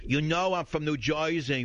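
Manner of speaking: hammed-up film-school New York accent; fake New York accent. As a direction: put on a bad movie New Yorker accent. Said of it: "the voice coaches have the idiots".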